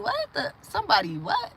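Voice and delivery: a sudden, very high "What the"; mock disbelief. Retelling her reaction to gang stalking, in high mock shock. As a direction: act floored by the news. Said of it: "I be like".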